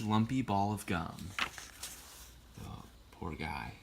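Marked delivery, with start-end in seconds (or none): 2.6-3.7 soft aside. Real, not acted. Reader's soft aside to listeners.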